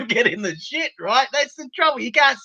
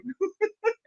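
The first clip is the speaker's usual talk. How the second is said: high laugh; 11 semitones higher, 9 dB quieter than usual. Real, not acted. Laughs at own joke.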